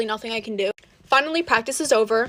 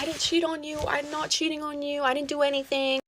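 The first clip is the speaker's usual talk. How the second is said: softer, shrugging mock denial; imitating nonchalant denial; 3 semitones higher, 6 dB quieter than usual. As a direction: brush it off like the boyfriend, quietly. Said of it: "He's just being so nonchalant".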